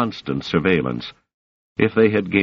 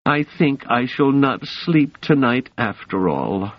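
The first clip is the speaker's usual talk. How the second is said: petulant; 8 semitones higher than usual. A narrator's reading. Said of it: "he added pettishly".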